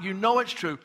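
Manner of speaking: normal talking voice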